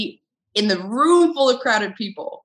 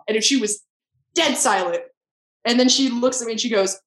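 ordinary talk, nothing marked

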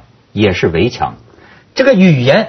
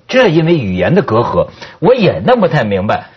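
normal talking voice

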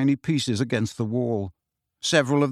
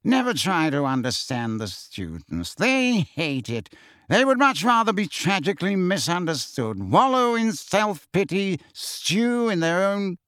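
Phineas Nigellus; 4 semitones higher than usual. A narrator's reading. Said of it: "said Phineas Nigellus slyly".

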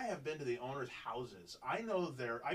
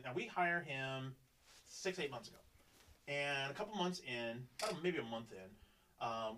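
ordinary talk, nothing marked

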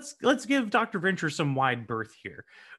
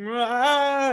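high, loud mock wail; imitated meltdown cry; tantrum wail; 11 semitones higher, 6 dB louder than usual. Youngest kid running off embarrassed, mock tantrum wail. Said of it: "he will, like, throw down ... and run off".

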